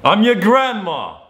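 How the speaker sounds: high, loud, drawn-out claim; playful over-the-top insistence; mock-argument yell. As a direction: outdo the caller with a slow shout.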